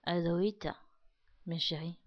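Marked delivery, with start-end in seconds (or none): none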